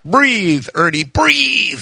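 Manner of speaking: high, drawn-out coaching plea; urging. A narrator's reading. Son coaches lizard on drive to vet, urging. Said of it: "he urged".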